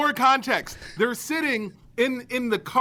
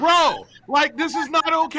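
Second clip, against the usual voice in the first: alarmed protest; 5 semitones higher, 4 dB louder than usual. Retold or imitated. Confronted by stranger, alarmed.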